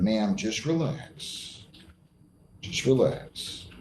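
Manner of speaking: slow, softened calming words; reassuring. Real, not acted. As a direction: ease her down gently, slowly. Judge calming the nervous defendant's wife.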